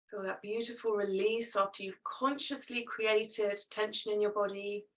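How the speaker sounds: ordinary talk, nothing marked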